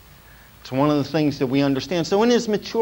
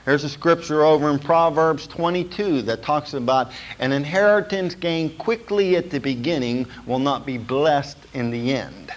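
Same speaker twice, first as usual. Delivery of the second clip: ordinary delivery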